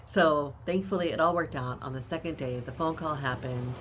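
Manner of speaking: normal talking voice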